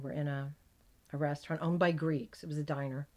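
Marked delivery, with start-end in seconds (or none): none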